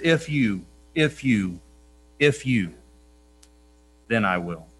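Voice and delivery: "If you" paused each time, then "then I will" dropped low; conditional cadence. Shown as a demonstration. Preacher contrasting the law's promises with God's, in a measured cadence. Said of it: "They went like this".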